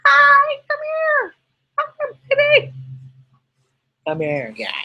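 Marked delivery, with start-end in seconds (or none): 0.1-2.7 much higher cooing call to the dog; squeaky dog-calling voice. Real, not acted. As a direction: coax the dog over in a tiny squeaky voice. Calling her dog Belle.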